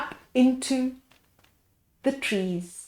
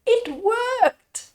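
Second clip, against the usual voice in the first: much higher triumphant shout; joyful shout; 12 semitones higher than usual. A narrator's reading. Mandy shouts in triumph at the moon. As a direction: cheer it out. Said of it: "shouted Mandy".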